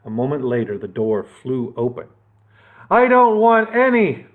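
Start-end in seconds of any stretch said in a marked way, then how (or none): 2.9-4.3 loud, higher mock boom. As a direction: boom it at the door. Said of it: "boomed Horace the Horrible".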